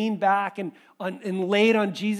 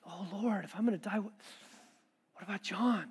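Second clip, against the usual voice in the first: much softer, tender worried ask; concerned Peter; 12 dB quieter than usual. Pretend. Peter quietly worried for his close friend John, soft. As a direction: hush it, caring for a friend. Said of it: "I wonder if it was just like".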